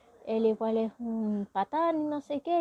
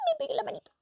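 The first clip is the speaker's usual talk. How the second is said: much higher, pained; hurt whimper; 13 semitones higher, much faster than usual. Real, not acted. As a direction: explain she hit her hand. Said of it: "¡ay!". (quejido agudo de dolor real)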